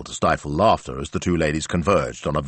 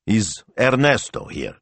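businesslike signore; 6 semitones higher, slower than usual. A narrator's reading. Father asks in character voice.

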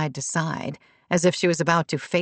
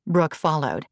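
usual speaking voice

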